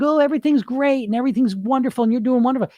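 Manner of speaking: high-pitched mock gushing; mock everything-is-lovely cheerleader; falsely positive voice. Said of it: "It's really not that".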